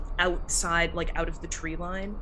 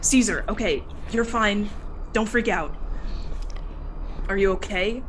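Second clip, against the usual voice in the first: slightly raised, louder urgent reassurance; steady a panicking friend, firmly; lightly voiced, pressing check-in; Luna Hanlon; 4 semitones higher, 5 dB louder than usual. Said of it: "Luna Hanlon just going".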